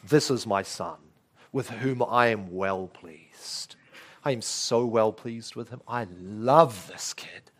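low, soft, slow affection; tender fatherly pride. Acted. God the Father speaking of his Son, tenderly proud. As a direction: voice a doting father, softly drawing out the praise. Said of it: "what's the backdrop?".

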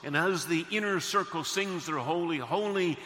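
loud, ringing pulpit delivery; full-force preaching. Real, not acted. Preacher describing God's throne to the congregation, full-force preaching. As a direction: proclaim the vision to the whole congregation.

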